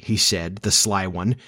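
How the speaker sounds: usual speaking voice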